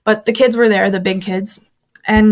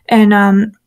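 ordinary delivery

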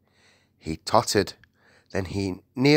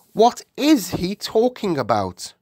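high, bewildered royal voice; baffled Queen; 11 semitones higher, 4 dB louder than usual. Acted. Queen asks about the giant, baffled. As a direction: demand an explanation in a raised voice.

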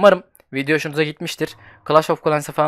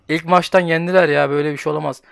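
normal talking voice

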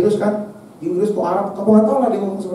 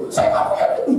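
mock woman's whine; 4 semitones higher than usual. Imitates woman saying no money.

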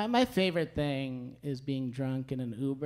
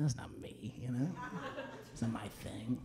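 hushed aside; 3 semitones lower, 11 dB quieter than usual. Retold or imitated. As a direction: drop to a near whisper, breathy and private. Quoted inner reaction to paycheck.

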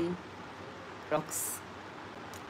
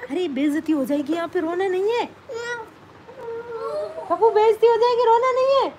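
urgent hushing plea; 11 semitones higher, 4 dB louder than usual. Real, not acted. Mother to child about to cry on live, urgent coaxing.

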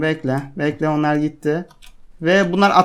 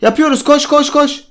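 being chased; 10 semitones higher, 5 dB louder than usual. Real, not acted. Fleeing attackers, urging to run.

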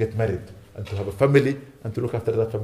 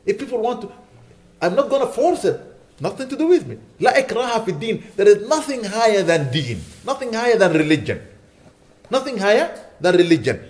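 raised, higher preaching voice driving each point home; forceful preaching; 6 semitones higher than usual. Real, not acted. Imam urging the congregation, forceful raised preaching. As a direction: preach it out to the hall with conviction.

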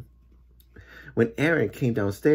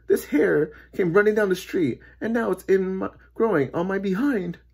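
raised-pitch complaint; distressed man; 9 semitones higher than usual. A narrator's reading. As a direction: tell your odd trouble with rising alarm.

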